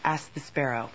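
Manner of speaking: ordinary delivery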